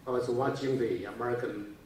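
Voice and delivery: usual speaking voice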